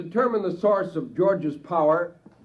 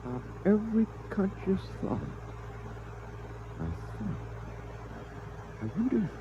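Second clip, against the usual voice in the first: musing to himself; 12 dB quieter than usual. Acted. Idea dawns, musing to himself.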